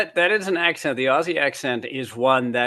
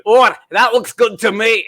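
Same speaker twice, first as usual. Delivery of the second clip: loud, high mock Scottish accent; 8 semitones higher, 11 dB louder than usual. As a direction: call it out as a hammed-up Scot. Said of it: "when Scottish people came over".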